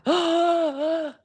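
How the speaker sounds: exaggerated gasp of revelation; mock shocked gasp. Host mocking his big banking reveal, fake astonished gasp. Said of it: "like, he just revealed something".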